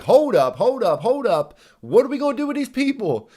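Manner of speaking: playing flustered locals who suddenly have a problem; panicked townsfolk. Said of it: "the whole town is like".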